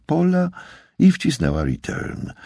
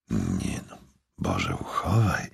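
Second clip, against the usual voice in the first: low, much softer, drawn-out hush; whispered; 8 semitones lower, 9 dB quieter than usual. A narrator's reading. Billy reacting to Stacy's name, whispering. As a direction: drop low and murmur it slowly. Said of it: "szepnął Billy".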